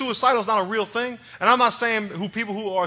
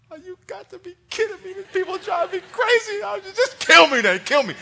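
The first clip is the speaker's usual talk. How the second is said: high, loud comic whine of exasperation; hammed-up fed-up outburst, much higher voice; exasperated Moses; 11 semitones higher, 5 dB louder than usual. Preacher acting Moses fed up with God, exasperated.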